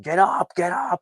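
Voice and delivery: high, urgent call to get up; a father urging his son up, pitched high; urgent mock yell. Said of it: "dad's like".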